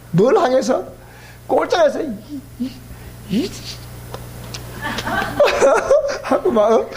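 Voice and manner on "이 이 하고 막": normal talking voice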